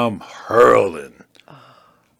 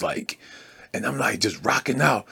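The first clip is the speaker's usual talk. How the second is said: ordinary delivery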